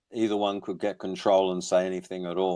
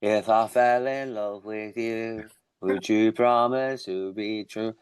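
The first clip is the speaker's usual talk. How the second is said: Liverpudlian twang; slower than usual. Pretend. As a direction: croon it slowly with a touch of Liverpool. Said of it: "nice little Liverpudlian twang you put in".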